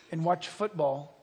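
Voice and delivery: ordinary talk, nothing marked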